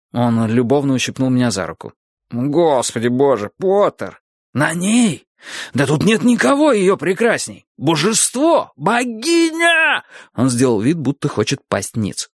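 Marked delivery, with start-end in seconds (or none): 2.3-10.0 rising, high, hammed-up adoration; mock rapture. Boris mockingly praises Potter's bride in rapture. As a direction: swoon over her theatrically, stretching Богиня.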